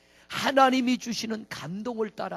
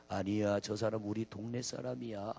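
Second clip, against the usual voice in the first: much lower, soft, slow Chungcheong father; slow Chungcheong drawl; 11 semitones lower, 12 dB quieter than usual. Retold or imitated. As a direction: calmly drawl like an old local. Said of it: "말을 느릿느릿 하는데". (느긋한 충청도 아버지 말투)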